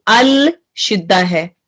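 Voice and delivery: ordinary delivery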